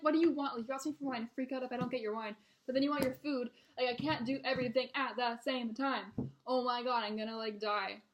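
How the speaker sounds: loud, exasperated rant; exasperated outburst. Retold or imitated. Server overwhelmed by regular's demands, loudly exasperated.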